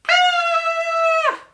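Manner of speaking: very high, loud startled scream; a girl waking to three bears and shrieking; Goldilocks screaming. Acted. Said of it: "She went".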